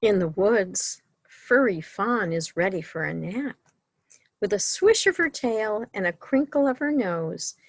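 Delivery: lively, sing-song picture-book reading; reading to children. A narrator's reading. Librarian reading a bedtime story to children, sing-song. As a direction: read the bedtime book to the little ones.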